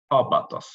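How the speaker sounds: "Abatos" stressed on the opening A; stress on first vowel. Shown as a demonstration. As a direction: hit the first syllable of "Abatos", then fall away. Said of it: "it is this vowel that should be stressed".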